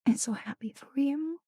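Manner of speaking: soft whispered congratulations; hushed heartfelt whisper. Real, not acted. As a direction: whisper I'm so happy for you close to the mic.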